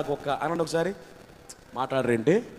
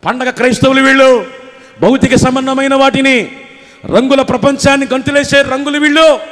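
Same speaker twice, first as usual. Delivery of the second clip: rising, driving sermon voice, louder and louder; fiery preaching; 6 semitones higher, 8 dB louder than usual. Acted. Preacher rebuking worldly believers, loud and fervent. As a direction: hammer the congregation about festival Christians.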